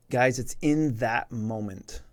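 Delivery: usual speaking voice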